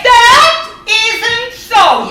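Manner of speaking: drawn-out, high protest; indignant denial. Acted. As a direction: stretch it out in outrage. Husband denies in family quarrel.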